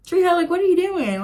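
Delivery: high, loud, playfully fed up; mock exasperation. Vlogger scolding Tree Hut over a missing product, mock exasperated. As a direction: scold the brand like a let-down fan.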